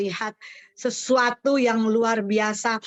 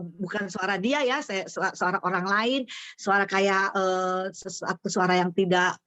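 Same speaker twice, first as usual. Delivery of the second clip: ordinary delivery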